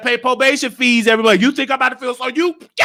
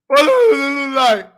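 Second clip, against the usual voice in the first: mock crying; 4 semitones higher, 3 dB louder than usual. Mock crying between complaints.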